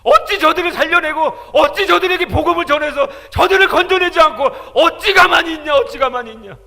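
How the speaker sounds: loud, high, anguished shout; shouted divine rebuke; Jesus rebuking. Retold or imitated. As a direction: cry out as grieving Jesus. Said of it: "저한테 그러는 거예요".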